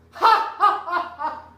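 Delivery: loud high burst of ha ha then dying away; demonstrated laugh. Shown as a demonstration. Master shows the guests how laughter goes, loud mock laugh. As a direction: show them a laugh, then let it drop. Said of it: "The same is true of the laugh".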